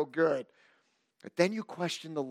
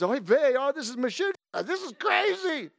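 comic Yiddish panic; 9 semitones higher than usual. Acted. Voicing panicked Gideon comically.

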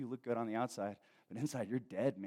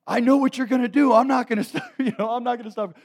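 put-on high, loud protesting kid voice; mock wailing child; 14 semitones higher, 16 dB louder than usual. Kid about to be spanked answers dad, mock wailing. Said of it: "immediately I'd just start crying".

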